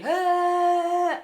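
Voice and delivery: long, high, loud held wail; imitated wailing noise. Noise waking her at five, long loud wail. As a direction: imitate a blaring wail that jolts you awake.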